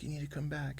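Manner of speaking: high, hushed retold plea; understated imitation of a tearful caller; imitating hysterical crying. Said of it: "she's crying hysterically".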